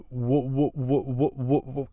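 stuck repeating wha through a headset; retold stammer, caught on the first sound; imitating a stutter. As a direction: mimic a friend's stutter on one syllable. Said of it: "through a headset with his wha".

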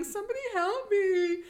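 high, whiny imitation of sobbing; mock crying plea. Retelling Sarah alone in the bathroom, mock tearful cry. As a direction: wail it like a hammed-up victim. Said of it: "She's crying".

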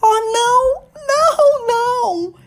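high, loud, terrified no; terrified cry. Acted. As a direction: shriek it out in sudden terror. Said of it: "gritou com repentina energia e sumamente aterrorizada".

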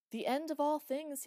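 loud, high call back. A narrator's reading. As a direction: call it back across the void. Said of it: "he called back".

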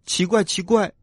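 put-on high, wondering childlike voice; puzzled tadpoles. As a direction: ask up high, baffled like little tadpoles. Puzzled tadpoles ask mother.

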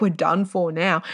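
usual speaking voice

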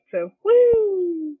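ordinary delivery

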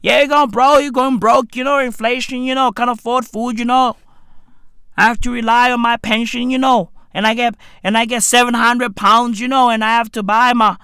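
quick, monotone Merseyside complaint; mock Merseyside accent. Merseyside parent listing bills, mock Merseyside accent. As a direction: rattle off woes in a put-on Merseyside accent. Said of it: "These Merseyside motherfuckers".

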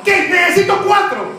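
high shouted call for helpers; pastor calling out. Acted. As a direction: shout it over the congregation.

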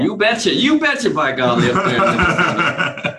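put-on high, loud, laughing Minnesota accent; Fargo Minnesota accent. Podcast host mocking Minnesota speech in a laughing Minnesota accent. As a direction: ham up a Fargo Minnesota "you betcha". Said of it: "it's the Fargo type".

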